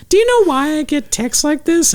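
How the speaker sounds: very high falsetto mother; mom impression. Mom asking her son about texts, high-pitched mock mom voice. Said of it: "She was like".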